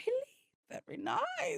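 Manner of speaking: a hint of an older lady cooing; high soft mock lady. Lady's compliment, accented.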